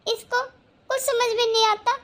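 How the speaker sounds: ordinary delivery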